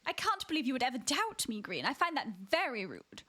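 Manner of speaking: mock-offended posh British; posh British accent. Streamer scolding doubting friend, mock-offended posh British accent. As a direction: act affronted in proper British English. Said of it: "posh British accent".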